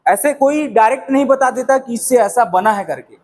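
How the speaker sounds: usual speaking voice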